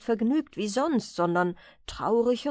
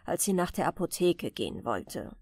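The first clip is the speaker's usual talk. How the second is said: ordinary delivery, nothing marked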